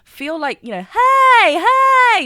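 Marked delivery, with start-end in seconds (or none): 1.4-2.3 calling out hey, hey. Shown as a demonstration. Coach telling the viewer to call out hey, loud. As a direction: shout it out like hailing someone. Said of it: "Just call that out".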